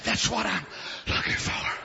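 soft, low, breathy-quiet seed; hushed, whispering seed. Acted. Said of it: "their little seed said".

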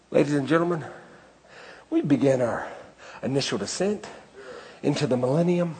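lightly voiced captain announcing arrival in heaven; pilot announcement.

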